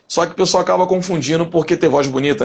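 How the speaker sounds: ordinary talk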